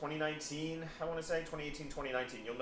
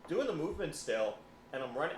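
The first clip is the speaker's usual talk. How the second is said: ordinary delivery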